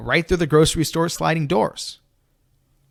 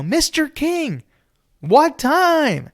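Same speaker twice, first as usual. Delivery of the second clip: slow, much higher shout through a car window; 13 semitones higher, 3 dB louder, much slower than usual. Retold or imitated. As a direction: call out high and drawn-out through the glass. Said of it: "shouting through the window".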